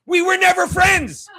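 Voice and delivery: loud, high, furious denial; angry shout. Real, not acted. Shouting at Chad that they were never friends, furious. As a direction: yell it straight at him, enraged.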